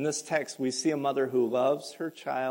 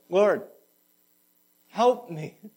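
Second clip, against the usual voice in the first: desperate plea; 6 semitones higher, 9 dB louder, much slower than usual. Acted. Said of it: "the desperation in her voice".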